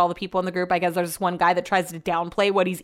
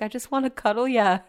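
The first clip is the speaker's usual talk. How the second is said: bad English accent; 2 semitones higher than usual. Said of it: "I can't do an English accent".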